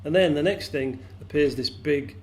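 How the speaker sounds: usual speaking voice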